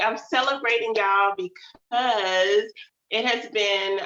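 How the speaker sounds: normal talking voice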